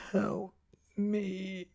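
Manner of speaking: high, drawn-out dying plea; thin, moaning cry for help; weak plea. A narrator's reading. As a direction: beg faintly with failing breath.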